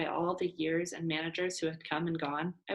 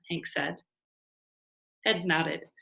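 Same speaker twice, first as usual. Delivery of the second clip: plain reading voice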